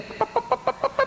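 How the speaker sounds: very high whispered pa-pa chatter; mock chatter noise. Husband mocks his wife's nonstop talking with high whispered chatter. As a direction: squeak out breathless nonsense chatter.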